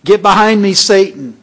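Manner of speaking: stern rebuke. Acted. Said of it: "Jesus says".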